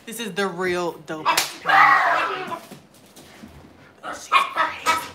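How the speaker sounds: mock shout. Real, not acted. Joking shout at the scene.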